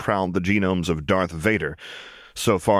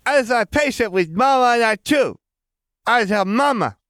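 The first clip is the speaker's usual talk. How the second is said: put-on very high, loud toddler babble; small child's voice; 5 dB louder than usual. A narrator's reading. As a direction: gush it out like an excited little boy. Gush of incomprehensible syllables.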